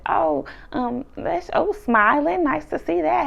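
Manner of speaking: high-pitched fake-sweet dig; shady pleasantry. Giselle remarking on Wendy's smile, shady pleasantry. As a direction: imitate a shady, halting compliment. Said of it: "a little bit shady".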